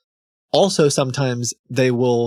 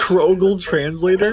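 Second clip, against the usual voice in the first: incredulous echo; 7 semitones higher, 4 dB louder than usual. Real, not acted. Streamer reacts to bot's claim.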